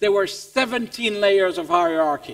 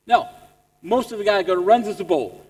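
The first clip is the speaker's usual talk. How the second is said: usual speaking voice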